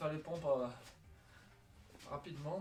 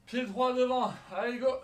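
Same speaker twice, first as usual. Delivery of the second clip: high, loud coach call; shouted command; 9 semitones higher, 13 dB louder than usual. Real, not acted. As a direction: bark the start out. Coach calling workout start.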